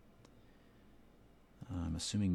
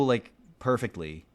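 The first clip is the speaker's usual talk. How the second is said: ordinary delivery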